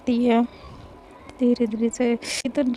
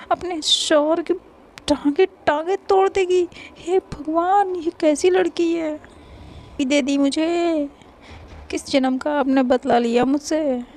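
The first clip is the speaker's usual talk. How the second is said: pleading to God in hammed-up despair; a playful fake complaint to the heavens; mock lament to God; 5 semitones higher than usual.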